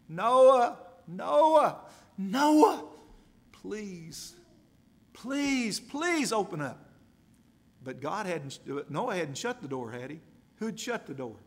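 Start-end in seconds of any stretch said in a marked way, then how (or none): 0.1-6.9 desperate cries. Acted. Drowning crowd begging Noah at the ark door, frantic shouting. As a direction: shout Noah high and loud, then plead. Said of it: "they beat on that door".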